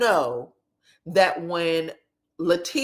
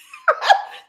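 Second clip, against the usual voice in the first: sudden high cackling laugh; a loud squealing laugh at the scene; high-pitched real laugh; burst of laughter; 5 dB louder than usual. Real, not acted. Laughing at the parents' reassurance.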